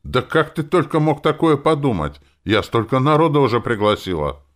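high, loud indignant protest. A narrator's reading. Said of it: "возмутилась она". (возмущённо, на повышенных тонах)

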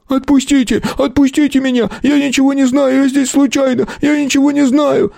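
very high hysterical pleading; screaming. A narrator's reading. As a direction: shriek in panic to be released. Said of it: "на всё помещение закричал бобёр". (истошный, отчаянный крик)

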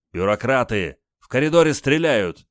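loud, high put-on indignation; feigned outrage. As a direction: play up the outrage, shouting. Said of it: "изобразил возмущение Олег".

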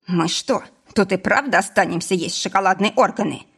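usual speaking voice

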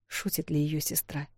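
usual speaking voice

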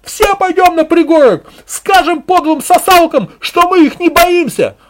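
very high, loud yell; piercingly high female cry; woman's shout of support. A narrator's reading. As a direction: shriek agreement from the crowd. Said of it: "поддержал другой женский голос".

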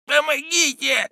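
very high strangled moan; moaning plea. A narrator's reading. Villain calling for help while throttled, moaning. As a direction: drag out a choked cry for help. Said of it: "стонал Роден".

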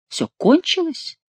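high, slow surprised question; incredulous echo. A narrator's reading. The king asks back whether it is over, incredulous echo. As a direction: ask it back, pitch leaping up on КОНЧИЛОСЬ. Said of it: "переспросил король".

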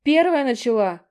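high, loud hurt squeak; offended squeak. A narrator's reading. Younger sister defending herself, offended squeak. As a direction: squeak out your innocence. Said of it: "обиженно пискнула она".

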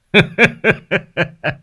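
high ringing laugh; tiếng cười vang. Acted. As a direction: let out a big knowing laugh. Thầy cười lớn. (tiếng cười cao vút)

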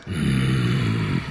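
low, long rumbling hum; bear growl. A narrator's reading. The bear considers Klonk's flattery, growling. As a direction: rumble a deep bearish hm. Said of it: "brummt der Bär".

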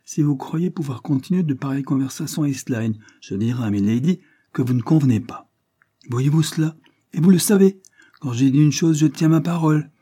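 resolute, building in force; firm and frank. A narrator's reading. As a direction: lay down the law to a colleague. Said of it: "interrompit Joyce avec fermeté et franchise".

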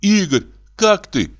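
high, slow worried question; anxious Nyura. Acted. Nyura asks Igor how he is, worried. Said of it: "взволнованно спросила Нюра".

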